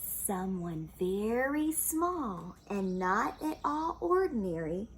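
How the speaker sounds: ordinary delivery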